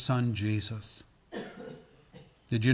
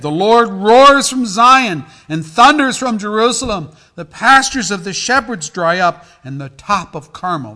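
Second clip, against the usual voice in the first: loud, raised-pitch scripture reading; preaching scripture; 9 semitones higher, 18 dB louder than usual. Acted. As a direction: proclaim the prophet's words to the congregation. Reads scripture aloud at force.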